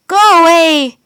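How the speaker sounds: loud shout at the wolf. A narrator's reading. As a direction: drive the wolf off with your voice. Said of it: "he shouted".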